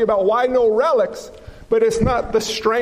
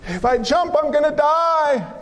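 scared hunter; 5 semitones higher than usual. Acted. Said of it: "The first man says".

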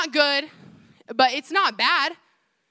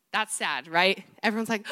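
normal talking voice

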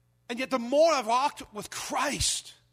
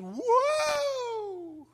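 high, far-off yelp of surprise; a sudden cry as the footing gives way; startled whoa cry. Retold or imitated. Pastor reliving the first step on the swaying rope, startled cry.

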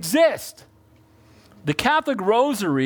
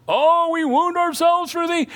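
mock wailing prophets; 7 semitones higher than usual. Mocking Baal prophets.

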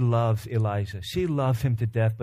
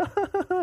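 high-pitched fake boo-hoo; badly faked crying farmer; short comic burst of pretend sobs; mock sobbing. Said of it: "You ever see a farmer going".

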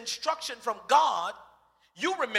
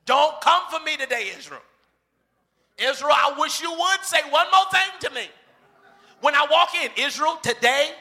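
higher, louder warning shout at Israel; angry shout; 4 semitones higher, 7 dB louder than usual. Pretend. Moses warns Israel, loud angry shout. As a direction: shout down the grumbling crowd.

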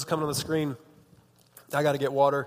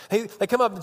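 ordinary delivery

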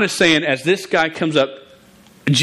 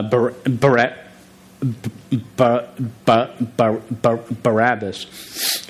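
slow, repeated blocks on the name Barabbas; real stutter; slower than usual. Real, not acted. Stuttering guy reading Scripture aloud, stuttering on a name. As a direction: get stuck on the first syllable, with halts, until the name comes. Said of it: "A st-, stuttering guy".

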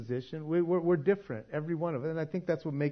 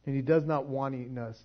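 usual speaking voice

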